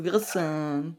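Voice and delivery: "gerissen" drawn out on the final syllable; slow, stretched "gerissen"; exaggerated emphasis. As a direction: mimic her overdone stress on "gerissen". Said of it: "Die betont echt alles so".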